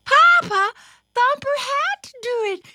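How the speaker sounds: put-on very high, loud, quick child voice; little sister pleading. A narrator's reading. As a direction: jump in to defend a brother. Little sister defending Thumper.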